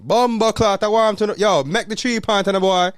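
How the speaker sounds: high, loud Jamaican patois outburst; real vex patois. Shown as a demonstration. Sports fan yelling at the Knicks, vexed patois. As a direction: yell at the game, hammed-up vexed. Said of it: "Real, real vex".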